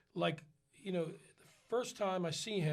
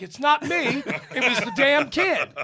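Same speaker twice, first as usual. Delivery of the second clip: raised, higher protest with laughter; loud laughing protest; 6 semitones higher, 11 dB louder than usual. Retold or imitated. A singer protesting to the cast that the kid farted. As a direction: insist it wasn't you, half laughing.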